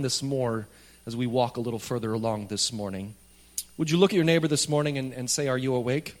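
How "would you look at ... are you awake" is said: normal talking voice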